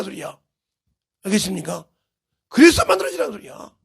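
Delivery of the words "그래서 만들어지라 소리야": loud, high-pitched insistence; forceful exhortation. Real, not acted. Preacher urges the congregation to be shaped, forceful exhortation. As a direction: drive the point home with raised voice. Repeats the call more forcefully.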